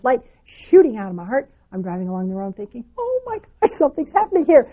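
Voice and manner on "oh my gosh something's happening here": excited gasp. Retold or imitated. Sudden spiritual rush while driving.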